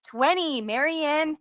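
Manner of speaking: usual speaking voice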